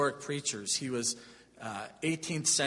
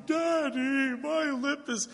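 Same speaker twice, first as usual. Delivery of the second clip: put-on high, tearful little boy's voice, much higher; crying child; 11 semitones higher, slower than usual. A young son crying to his father in the night, high-pitched. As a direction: son sobbing to his dad at three in the morning, high and tearful. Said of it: "and he's crying".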